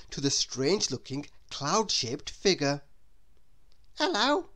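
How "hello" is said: put-on higher child's greeting; a light boyish hello; little boy greets shyly; lightly voiced boy. A narrator's reading. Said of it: "he said".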